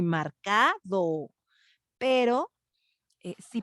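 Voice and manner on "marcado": marcado stretched very slowly, CA held long; over-marked speech. Shown as a demonstration. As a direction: say marcado as mar-CAAA-do. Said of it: "hablando así como que muy".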